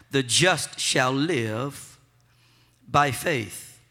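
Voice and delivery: The just shall live, slow, then a pause, then by faith; pause before by faith. Shown as a demonstration. Preacher teaching the church a pause, slow. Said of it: "Gotta put a little pause in there".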